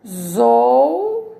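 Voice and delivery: "tesoura" drawn out syllable by syllable; "tesoura" as three separate, stretched beats; split into syllables. Shown as a demonstration. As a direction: sound out "tesoura" slowly with long gaps, te-sou-ra. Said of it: "A primeira palavrinha é".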